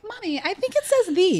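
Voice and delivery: high, lightly voiced child correcting mommy; a touch of a fussy little kid; imitating a little boy. Son correcting mom at bedtime, in a high child voice.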